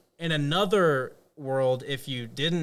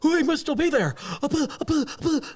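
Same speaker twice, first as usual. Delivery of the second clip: very high cartoonish squeak; high squeaky voice; 13 semitones higher, 4 dB louder than usual. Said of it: "he's like".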